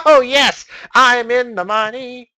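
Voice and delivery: high, loud laughing cheer; gleeful cheer. Acted. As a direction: crow with delight over the win. Cheers at getting next week.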